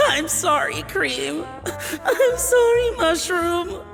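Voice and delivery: whimpering, pitch climbing on the second apology; tearful apology. Acted. Scolded cook apologizing to wasted cream and mushroom, tearful whimper. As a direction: sob out a pitiful apology.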